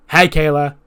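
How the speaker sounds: loud, bright mock-showman hello; hammed-up wrestler hello; cheery Jericho greeting. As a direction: boom the greeting like a smug star.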